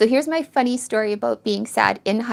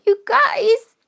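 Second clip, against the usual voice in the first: high, drawn-out emotional cry; tearful, touched; 12 semitones higher, 5 dB louder than usual. Real, not acted. Streamer moved by viewers' compliments, tearful and high. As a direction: voice lifting through real tears. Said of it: "because of the crying".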